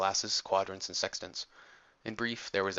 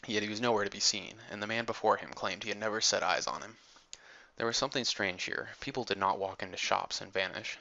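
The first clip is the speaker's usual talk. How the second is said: ordinary delivery, nothing marked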